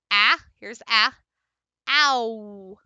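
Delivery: ah held loud, then gliding into ow; modeled ow glide. Shown as a demonstration. Teacher demonstrating the ow glide, loud and drawn out. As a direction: open wide on ah, slide to ow. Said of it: "And then you have ow".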